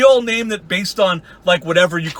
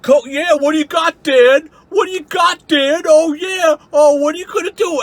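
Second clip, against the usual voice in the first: much higher, louder goading taunt; mock taunting Wally; 9 semitones higher, 4 dB louder than usual. Mocking Wally daring Dan to fight, high and loud. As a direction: ham up his dare to fight. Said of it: "he's just like".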